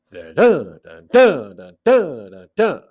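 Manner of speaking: da-DUN with loud, heavy DUN beats; swinging weak-strong chant, dun punched; metrical stresses. Shown as a demonstration. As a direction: pound out the meter on each strong dun. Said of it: "The meter is".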